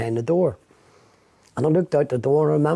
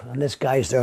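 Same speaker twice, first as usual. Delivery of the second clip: normal talking voice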